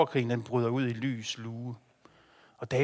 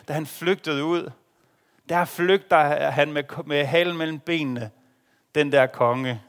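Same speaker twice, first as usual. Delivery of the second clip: full-force preaching; 2 semitones higher, 6 dB louder than usual. Acted. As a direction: proclaim it to the congregation. (kraftfuld prædiken)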